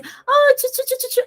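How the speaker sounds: high-pitched doggy cooing; excited pet talk. Shown as a demonstration. Trainer showing how to greet a little dog, excited pet talk. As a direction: coo playfully in a very high voice. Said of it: "se você chegar pra ele e falar assim".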